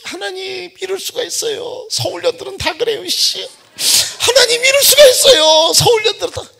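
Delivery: high, loud wailing prayer; crying prayer. Retold or imitated. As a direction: wail to God in hurt. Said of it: "매일 기도했대". (억울해서 울부짖는 기도)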